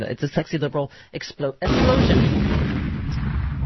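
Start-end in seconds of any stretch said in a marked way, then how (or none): 1.6-3.6 drawn out. Acted. Drawn-out punchline word.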